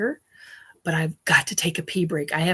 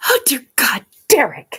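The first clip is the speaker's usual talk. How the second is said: higher, louder oh dear God; mock exasperation; 5 semitones higher, 7 dB louder than usual. Real, not acted. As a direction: cry out in scandalized delight. Reacting to Derek's joke.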